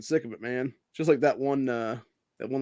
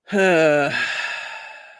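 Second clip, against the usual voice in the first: loud, falling sigh; long sigh; 4 semitones higher, 6 dB louder than usual. Real, not acted. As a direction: let out a long, weary breath. Winding down, long audible sigh.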